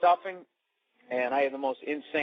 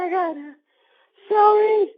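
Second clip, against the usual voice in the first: very high, strained helpless moan; squealed, hammed-up confession; mock moment of weakness; 7 dB louder than usual. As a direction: blurt the recipe in high mock ecstasy. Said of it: "You're like".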